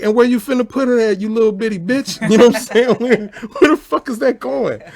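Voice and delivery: high, loud incredulous squawk at her; hammed-up shocked yelling; mock outraged yell. Telling the greedy woman off about her plate, in a high mock yell. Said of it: "I'm like".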